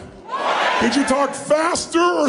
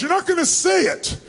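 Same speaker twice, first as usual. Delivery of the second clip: usual speaking voice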